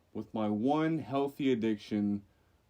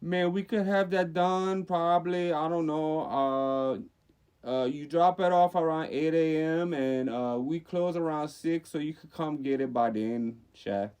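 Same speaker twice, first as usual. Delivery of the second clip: put-on Cajun accent; 5 semitones higher, 3 dB louder than usual. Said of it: "do a Cajun accent".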